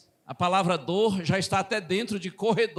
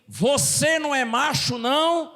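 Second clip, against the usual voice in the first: louder, higher, authoritative challenge; irritated older brother; 6 semitones higher, 4 dB louder than usual. Retold or imitated. Older brother goading the younger mid-argument, irritated. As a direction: throw down a riled brotherly challenge. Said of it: "e já nervoso também".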